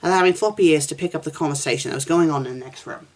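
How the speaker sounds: ordinary delivery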